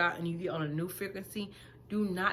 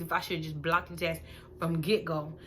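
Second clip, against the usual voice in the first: ordinary talk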